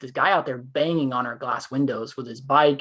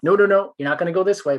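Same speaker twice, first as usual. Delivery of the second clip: much higher, louder no no no; firm blocking call; 6 semitones higher, 6 dB louder than usual. Retold or imitated. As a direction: stop him cold at the door. Blocking the man from the back door.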